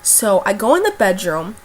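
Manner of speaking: ordinary delivery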